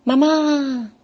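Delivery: high, loud cry for mamá; calling out. A narrator's reading. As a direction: shout out for your mother, drawn out.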